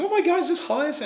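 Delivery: high-pitched excited question; gushing, voice right up; delighted customer. Retold or imitated. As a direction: imitate an eager shopper. Retold delighted first customer.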